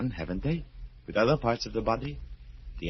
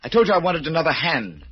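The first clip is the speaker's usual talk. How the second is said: raised, higher voice, insisting hard; angry demand; 7 semitones higher, 8 dB louder, faster than usual. Acted. Crippled man angrily reminds the surgeon of his demand. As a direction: bark the reminder at him.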